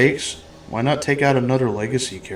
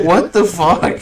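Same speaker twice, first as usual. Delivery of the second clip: incredulous; 5 semitones higher, much slower than usual. Retold or imitated. Incredulous reaction quote.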